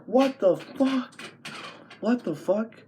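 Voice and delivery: high, stretched-out disbelief; shocked reaction. Retold or imitated. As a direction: retell it as high-pitched, incredulous shock. Acts out shock at friend's news.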